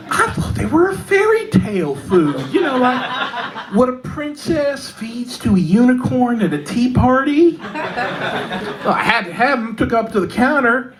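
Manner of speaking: ordinary talk, nothing marked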